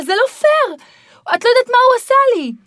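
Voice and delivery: put-on the accused child protests to his mother; protesting child. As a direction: whine that it's unfair.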